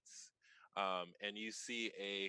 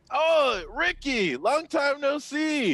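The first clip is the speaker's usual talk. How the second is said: much higher, loud and slow; high excited greeting; 16 dB louder, slower than usual. Acted. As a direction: gush a loud, high-pitched welcome. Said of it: "I open the door and I go".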